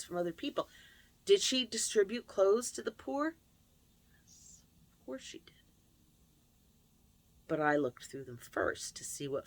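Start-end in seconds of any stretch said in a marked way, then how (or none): 4.1-5.5 dry, whispered aside; sarcastic whisper. Real, not acted. Telling the listener about her mother's charity, in a sarcastic whisper. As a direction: let the irony slip out under your breath.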